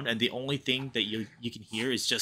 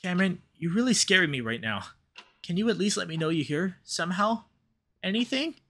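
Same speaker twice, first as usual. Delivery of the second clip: higher, rising anxious pleas; frightened calling out; 7 semitones higher than usual. Acted. Devin calling for Cameron who won't answer, frightened. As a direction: call into the silence, more afraid each time. Devin calls to silent partner.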